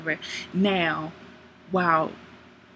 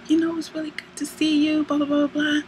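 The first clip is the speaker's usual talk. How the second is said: higher-pitched imitation of a smitten woman; gushing woman; 8 semitones higher than usual. Woman greeting Damon at the airport, gushing. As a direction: gush it in a high voice, skimming the small talk. She gushes on meeting him.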